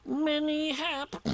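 high, slow tiny-animal voice, cut off; Piglet. A narrator's reading. Piglet begins the greeting again, high and careful. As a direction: pipe it slowly until interrupted.

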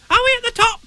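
very high excited squeak; tiny voice squeaking with excitement; excited Roo. Acted. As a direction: squeal it like a thrilled little one. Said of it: "he said excitedly".

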